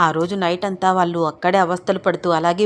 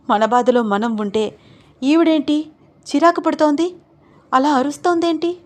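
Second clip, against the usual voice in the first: irritated outburst; 7 semitones higher, 3 dB louder than usual. Acted. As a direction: vent your annoyance at her. Arundhati annoyed at the shouting.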